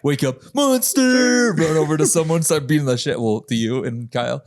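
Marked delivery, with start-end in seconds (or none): 0.5-1.5 high, drawn-out mock holler; high-pitched yell of a boy on the attack; imitated battle cry. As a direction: holler it like a cartoon alarm. Acts out boy waking up yelling.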